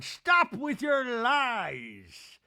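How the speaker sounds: high, loud, drawn-out wail; hammed-up slow accusing shout; mock dramatic cry. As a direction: stretch it out in overblown indignation. Imitates Meredith's dramatic preview line.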